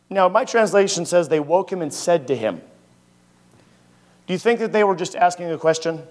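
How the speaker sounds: usual speaking voice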